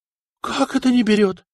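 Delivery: can't believe what he hears; astonished question; amazed. A narrator's reading. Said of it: "Ставракий удивился так".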